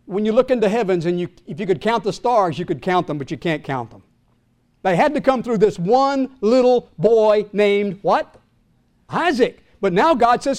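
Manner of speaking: ordinary delivery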